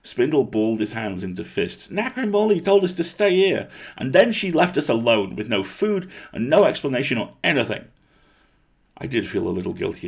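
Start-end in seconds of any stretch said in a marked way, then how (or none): 1.9-7.8 high, loud indignant rant; angry outburst. A narrator's reading. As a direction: rant as you tear the curtain aside. Angry girl storming out.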